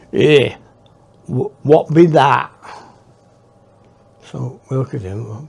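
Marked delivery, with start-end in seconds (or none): 0.1-2.5 put-on slow, louder Cornish farm-boy drawl; a curious country kid asking in broad Cornish; Cornish accent. Local boy questions strangers about odd bag, slow Cornish accent. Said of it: "in a sort of Cornish accent".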